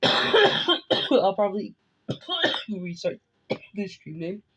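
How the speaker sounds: coughing through the words; real coughs breaking up the line; sick cough. Real, not acted. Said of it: "I'm sick right now".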